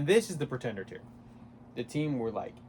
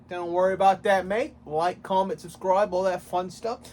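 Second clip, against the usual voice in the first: higher, louder mock Australian accent; hammed-up Australian accent; 6 semitones higher, 5 dB louder than usual. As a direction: ham up an Australian outro. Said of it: "Why am I doing an Australian accent?".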